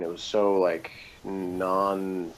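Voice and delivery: normal talking voice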